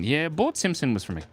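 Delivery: ordinary talk, nothing marked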